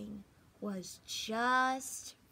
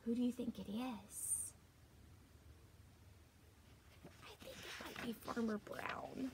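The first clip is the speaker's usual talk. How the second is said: hushed; 11 dB quieter than usual. Acted. Suspenseful guessing to children.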